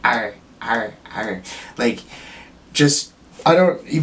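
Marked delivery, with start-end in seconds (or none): 0.1-1.4 growling grunts. Retold or imitated. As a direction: grunt like you're wrestling a bite. Struggling to eat while driving.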